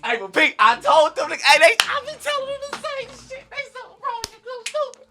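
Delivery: high, squealing excited rant; overexcited. Real, not acted. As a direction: voice climbing into a shrieky squeal. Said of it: "I'm just a little excited".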